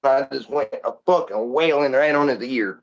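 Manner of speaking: ordinary talk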